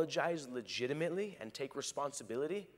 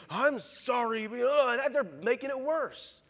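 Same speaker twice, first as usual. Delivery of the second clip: higher, loud whiny sorry; sulky kid apology; 9 semitones higher, 6 dB louder than usual. Retold or imitated. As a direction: whine the sorry like a grudging child. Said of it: "what they're actually saying is".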